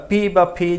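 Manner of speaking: পি with plain P, ফি with aspiration. Shown as a demonstration. Teacher letting learners pick either, পি or ফি. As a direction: say both forms, পি and then ফি. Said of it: "যেটি চান উচ্চারণ করতে পারেন".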